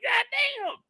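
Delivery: high whiny groan; kid's groan. Retold or imitated. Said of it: "You're like".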